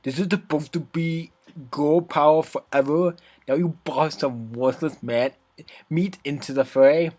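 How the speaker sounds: put-on sassy bratty girl, scornful. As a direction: scoff like a bratty tsundere. Voicing brash Natsuki.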